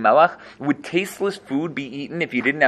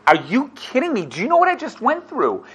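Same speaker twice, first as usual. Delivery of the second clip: incredulous; 10 semitones higher than usual. Acted. Job, answering his friend, higher and incredulous. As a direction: pitch jumps way higher in disbelief. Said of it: "like, incredulous".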